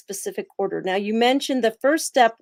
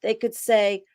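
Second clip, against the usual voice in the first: usual speaking voice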